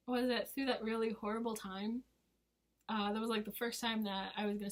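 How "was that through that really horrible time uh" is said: ordinary delivery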